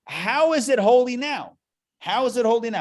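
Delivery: high, loud "How is it holy now?" with HOW stressed; insistent question. Shown as a demonstration. Rabbi pressing the class with a high, loud challenge. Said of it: "How is it a holy land yet?".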